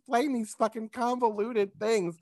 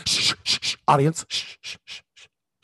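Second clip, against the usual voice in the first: sharp, insistent shushing; mock a host hushing a crowd with hisses; host shushing the audience; 4 semitones lower, faster than usual. Game show host to studio audience, insistent shushing. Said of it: "turn to the audience and go".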